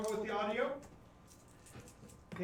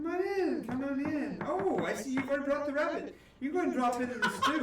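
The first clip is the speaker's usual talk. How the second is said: put-on cooing old woman; 8 semitones higher, 5 dB louder than usual. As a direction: coo over him like a doting grandma. DM voices cheerful witch.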